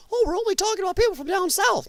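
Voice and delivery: high, loud-ish mock objection; mocking listeners' assumption. Mocks what listeners think.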